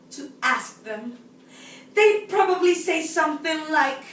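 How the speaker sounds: theatrical confiding with long pauses, swelling on key words; exasperated woman's monologue. Acted. Woman telling us about the supermarket, exasperated. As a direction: let the frustration build in swings from hushed to emphatic.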